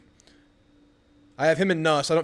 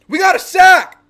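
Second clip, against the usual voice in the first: screamed very high and loud; celebrating shout; 14 semitones higher, 14 dB louder, slower than usual. Real, not acted. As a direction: explode with joy. Celebrating a sack.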